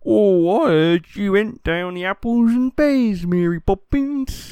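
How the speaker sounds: slow, hammed-up cockney; mock cockney accent. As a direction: lay on a fake Cockney.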